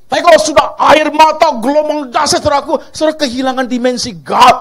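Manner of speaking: loud, high fervent preaching; full-force preaching. Acted. Preacher rouses the congregation, loud and fervent. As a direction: belt it out to the whole church.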